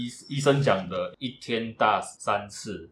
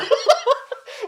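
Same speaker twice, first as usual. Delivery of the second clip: loud high squealing laugh; sudden high giggle; high burst of laughter; 6 dB louder than usual. Real, not acted. Laughing at the break mix-up.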